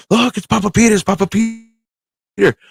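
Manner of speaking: put-on high, childlike cry of delight; a little niece spotting her grandpa's name, squealing; excited child calling out. Niece on the subway calling out to her family, high and excited.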